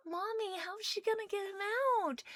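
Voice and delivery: put-on high, soft little girl's question. Little girl asking her mother about the rescue, high and soft. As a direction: ask like a small curious child, softly and high. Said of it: "this little girl goes".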